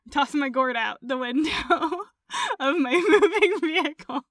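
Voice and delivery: talking through laughter; real giggles breaking into the sentence; laughing. Real, not acted. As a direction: crack up while getting the words out. Cracking up at running gourd joke.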